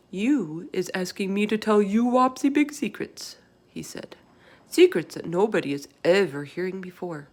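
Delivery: wary giant, warning her off; secretive giant. Acted. Giant voiced in read story.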